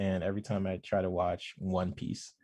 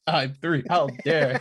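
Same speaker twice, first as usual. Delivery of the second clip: laughing, high indignant noble; mock royal outrage; 6 semitones higher, 6 dB louder, slower than usual. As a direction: huff like offended royalty.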